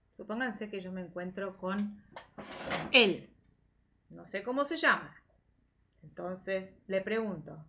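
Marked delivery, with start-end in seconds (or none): none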